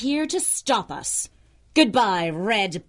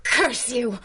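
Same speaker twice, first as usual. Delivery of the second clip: villainous curse; 4 semitones higher, slower than usual. Acted. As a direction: spit the curse at both of them. Defeated villain rages at heroes.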